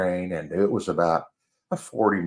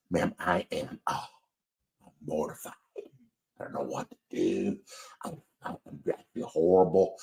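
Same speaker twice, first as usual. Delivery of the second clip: retold apology, softer and fading to a whisper; mortified apology; 3 semitones lower, 6 dB quieter than usual. To the lady he spilled coffee on, a hushed mortified apology. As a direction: sink into embarrassed, breathless sorrys.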